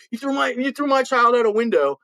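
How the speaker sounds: high, outraged mother's accusation; shrill accusing mother. Retold or imitated. Accusing mother voiced in story.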